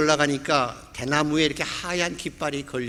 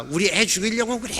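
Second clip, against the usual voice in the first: high-pitched mock yell of an alarmed mother; raised, higher voice imitating her outburst; retold yelling; 7 semitones higher than usual. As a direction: act out a mother crying out in accusation. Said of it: "소리 질러서".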